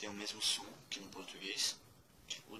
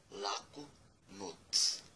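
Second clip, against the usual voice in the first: "laku noc" said very slowly, each syllable apart; slow clear demo; much slower than usual. Shown as a demonstration. Teacher slowly modelling Croatian good night for students. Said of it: "Boa noite em croata é laku noc".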